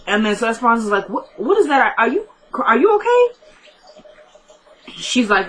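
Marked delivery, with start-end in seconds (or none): 1.1-3.3 high, halting, climbing questions; retold startled concern. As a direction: imitate a friend puzzled by sniffling. Retold friend hears sniffing, asks.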